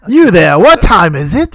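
high, very loud mock-Cockney call; Dickens character. Co-host parodies a Dickens novel, shouted mock-Cockney. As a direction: holler like a hammed-up Dickens Londoner. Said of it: "he's from a Dickens novel".

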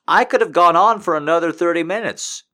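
loud, high, gushing boast; excited boast. Real, not acted. Boasting about stamina.

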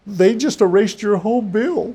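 slow, high-pitched disbelief; counselor's stunned news. Retold or imitated. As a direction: retell big news in slow, hushed wonder. Retells counselor's astonishing phone news.

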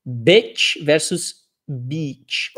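very slow "bitch versus beat" with a pause on "versus". Shown as a demonstration. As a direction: drag out each word. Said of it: "então".

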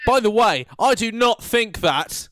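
high, loud "I do not think that"; emphatic disclaimer. Pretend. Addressing people at home, loud slow emphatic disclaimer. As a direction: hammer each word of "I do not think that", slowly.

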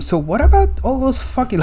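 a sudden high exasperated cry; indignant outburst. Retold or imitated. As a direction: blurt it out in mock outrage. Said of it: "my mind just went to".

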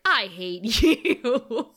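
slow, drawn-out mock loathing; hammed-up disgust breaking into a laugh; Cordelia, disgusted. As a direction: drag out every word in disgust. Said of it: "and she's like".

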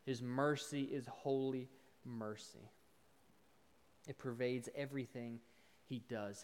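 soft, low, solemn preaching voice; hushed preaching. Real, not acted. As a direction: bring it down to a reverent hush.

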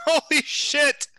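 high, loud, drawn-out swearing in amazement; amazed outburst. Real, not acted. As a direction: stretch it out in real astonishment. Amazed by a lucky dice roll.

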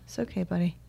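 hushed, gentle words to the dog; soft soothing. Retold or imitated. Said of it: "I went".

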